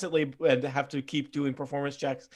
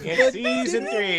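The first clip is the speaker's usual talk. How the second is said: excited high squeal; 5 dB louder, slower than usual. Acted. Echoing dramatic announcement.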